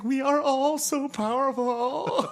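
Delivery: high, slow, grandly theatrical voice; hammed-up theater kid, very high and slow; mock theater kid. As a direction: declaim like a drama-class kid. Drama-kid leader voiced.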